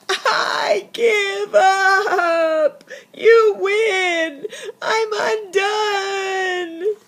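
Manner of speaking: high, slow, hammed-up sobbing; weeping. Bear gives up to the mouse, exaggerated weeping. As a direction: wail it out in defeat. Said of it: "Bear fell to the floor and wept".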